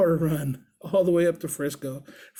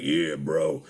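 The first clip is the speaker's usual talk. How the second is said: deep biker voice; mock biker's low agreement; imitated big biker; 11 semitones lower than usual. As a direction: drop way down to play the burly biker. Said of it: "He's like".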